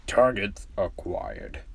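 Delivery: low, slow machine announcement; deep, measured robot voice; robot pet. Acted.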